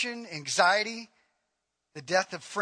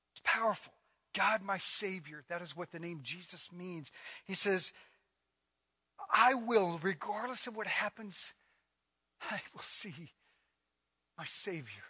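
hushed preaching; 5 semitones lower, 6 dB quieter than usual. Acted. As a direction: draw them in close with a near-murmur. Hushed, intimate scripture reading.

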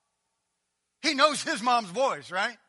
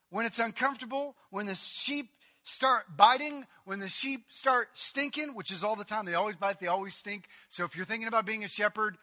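ordinary talk

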